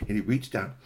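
ordinary delivery, nothing marked

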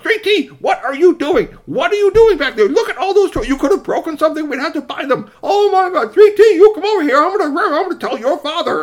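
high, loud scolding with laughter in it; mother telling her son off, half-laughing. Acted. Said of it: "His mother was doing her own job".

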